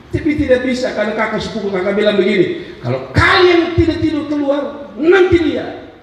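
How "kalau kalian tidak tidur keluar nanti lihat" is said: high-pitched acted warning; stern threat. Older cousin warns children who won't nap, high threatening voice. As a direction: threaten the kids, voice pushed up high.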